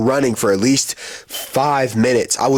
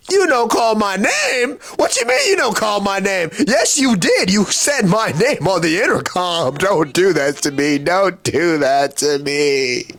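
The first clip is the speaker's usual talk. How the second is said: indignant outburst; 7 semitones higher than usual. Acted. Passenger protesting to the desk clerk, high mock indignation. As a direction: start high and outraged, then come down into pleading.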